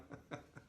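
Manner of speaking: quiet, breath-led laugh; light little laugh; soft chuckle. Real, not acted. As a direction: chuckle softly at your own remark. Laughs at own invitation.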